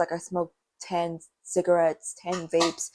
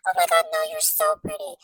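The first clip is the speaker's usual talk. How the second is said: much higher sugary mock voice; mock fake-nice girl. Imitating girls lying to her in a high gushing voice. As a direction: gush in a high falsetto. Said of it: "they'll be like".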